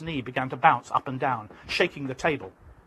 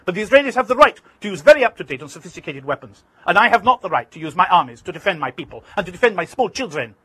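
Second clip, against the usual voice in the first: indignant protest; 4 semitones higher, 8 dB louder than usual. Retold or imitated. Arafat protesting angrily to interviewer.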